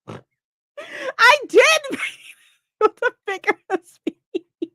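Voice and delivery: laughing cry. Real, not acted. Laughing defence to chat.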